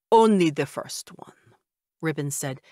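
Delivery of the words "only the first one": put-on slow, defensive old-world vampire; vampire father. A narrator's reading. Vampire father defending his son to the narrator, in a slow vampire accent. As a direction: correct her with slow vampire dignity.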